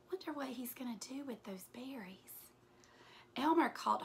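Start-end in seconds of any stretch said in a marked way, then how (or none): 0.1-2.5 soft, quick conspiratorial whisper; whispered aside. Real, not acted. Reader whispering a guess to the children. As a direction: lean in and wonder aloud in a whisper. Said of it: "reader's whispered aside to listeners".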